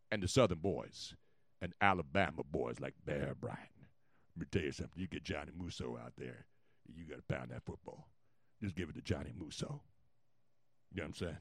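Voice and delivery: low, soft Southern drawl; old-time Alabama football man's drawl. Acted. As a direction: drawl it deep and quiet like a Southerner. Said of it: "the slow Southern drawl".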